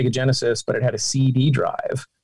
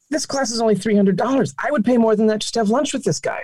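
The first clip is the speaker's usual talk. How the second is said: higher-pitched, mildly excited retold thought; lightly acted eagerness; eager inner thought; 8 semitones higher than usual. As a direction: voice a keen realization, pitched up. Retelling own eager thought about class.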